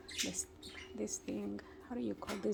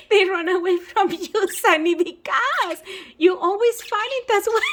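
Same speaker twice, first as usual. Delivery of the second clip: laughing; 7 semitones higher, 10 dB louder than usual. Real, not acted. Laughing at the birds fleeing Sonny.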